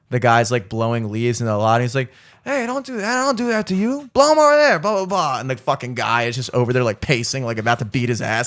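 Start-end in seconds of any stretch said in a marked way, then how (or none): 2.4-5.4 high, hammed-up boss yelling; yelling owner. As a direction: bark orders at the worker. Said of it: "yelling at a guy".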